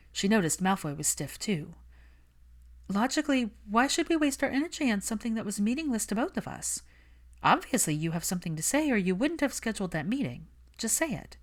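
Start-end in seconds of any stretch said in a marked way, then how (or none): 2.9-11.4 put-on lightly raised, brisk young woman; Hermione's voice. Hermione brushing off Malfoy, cool and defensive. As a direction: keep her cool and a little defensive.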